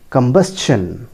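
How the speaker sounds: combustion as kum-BUS-chun for repeating; correct pronunciation. Shown as a demonstration. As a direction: model the S-CH in combustion. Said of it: "Try to repeat after me". (combustion का सही उच्चारण)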